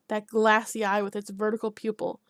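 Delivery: usual speaking voice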